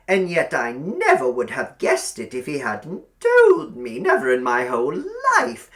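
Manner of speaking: high, loud, cloying landlady voice; sugary old landlady. A narrator's reading. Landlady inviting Billy to sit by the fire, cloying. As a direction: coax him over like a spider.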